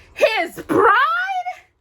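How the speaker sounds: high, loud shrieked question. Acted. Stepsisters hearing the prince will marry, shrieking. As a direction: shriek it in disbelief. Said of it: "the two sisters shrieked".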